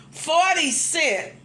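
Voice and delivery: "Forty cent" said very slowly, each word weighted; emphatic slow. Real, not acted. Storyteller to viewers about the small change, slow and emphatic. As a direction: drag it out for emphasis.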